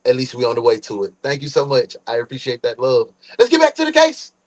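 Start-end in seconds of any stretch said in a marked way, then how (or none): 3.4-4.3 high, loud, fast hype host; hype announcer voice. Acted. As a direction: pump the chat back up. Streamer hype return to case.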